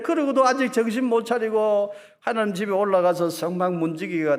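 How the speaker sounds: high, loud jeering voice; mocking scoffers. Scoffers mocking the sons of Korah, loud jeering. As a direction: sneer at the faithful from a crowd. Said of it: "비웃고 조롱하는 거예요".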